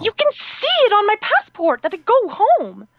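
high, drawn-out indignant plea; exasperated protest. Retold or imitated. Traveler accused of secretly living in the States, exasperated protest.

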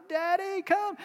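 high, pleading call for Daddy; child's hollered plea. Retold or imitated. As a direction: beg Daddy to come like a hurt child. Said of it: "he screamed and he hollered".

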